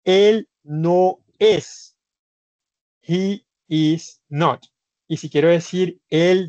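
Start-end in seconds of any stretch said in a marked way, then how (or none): 0.0-2.0 él no es drawn out slowly and higher; lento, como dictando; slow, spelled out. Shown as a demonstration. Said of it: "Si yo digo". 3.0-4.6 he is not drawn out slowly; slow, spelled out. Shown as a demonstration. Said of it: "Si yo digo".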